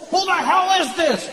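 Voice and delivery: high, indignant demand; indignant outburst. Acted. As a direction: snap at a prank caller, high-pitched. Annoyed answerer of prank call.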